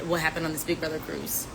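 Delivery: normal talking voice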